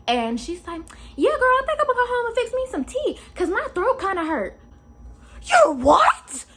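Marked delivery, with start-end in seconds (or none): 1.2-4.6 put-on high, chatty girlfriend voice; high voice of the lady talking to her homegirl; lady on the phone. 5.4-6.4 loud, cried-out shock; loud cry of disbelief; outraged disbelief. Real, not acted. Outraged reaction.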